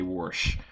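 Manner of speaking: warsh with an r before the sh. Shown as a demonstration. Explaining the regional word in a warsh pronunciation. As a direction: say warsh, adding an r to wash. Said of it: "mispronunciation of the word wash".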